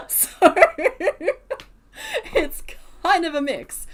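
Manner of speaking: high, loud burst of laughter into words; real laughter. Real, not acted. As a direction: crack up, then push the words out through laughing. Cracks up at own harsh review.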